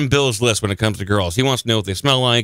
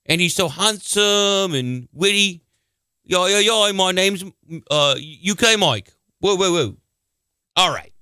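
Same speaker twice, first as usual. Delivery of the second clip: fake British accent; 5 semitones higher than usual. Host posing as British fan Mike in a fake British accent. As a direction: gush like a fan in mock British. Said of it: "your fake accent".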